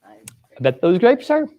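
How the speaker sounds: oblivious, clueless reading; totally clueless. Shown as a demonstration. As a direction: say it as if you have no idea. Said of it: "with a totally clueless voice".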